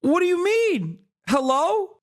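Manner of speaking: high, incredulous mock shock; high retold what-the-fuck surprise; retold disbelief. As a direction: react in stunned disbelief. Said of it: "I was like".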